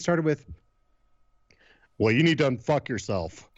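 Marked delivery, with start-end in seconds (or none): 2.0-3.5 dropped commanding voice. Retold or imitated. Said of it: "I used to drop my voice when I was in charge".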